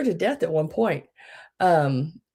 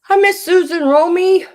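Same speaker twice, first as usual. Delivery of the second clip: much higher and louder hello; bright sung-out welcome; high greeting; 14 semitones higher, 9 dB louder than usual. Real, not acted. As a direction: call out cheerfully to a friend. Greeting a viewer.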